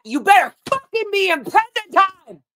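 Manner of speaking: loud, high drill-style order; yelled command. Retold or imitated. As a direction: yell it as an angry reprimand. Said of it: "we were yelled at constantly".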